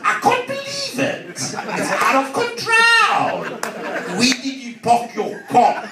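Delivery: high, exaggerated South African voice; South African accent. South African friend complaining to the comedian, exaggerated accent. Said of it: "he said".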